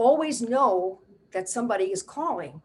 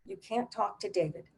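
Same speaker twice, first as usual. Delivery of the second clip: ordinary talk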